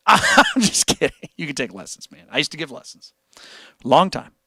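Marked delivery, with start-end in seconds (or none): 0.0-1.2 laughing through the words. Real, not acted. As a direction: say it through a laugh, higher pitched. Laughs off his nerd jab.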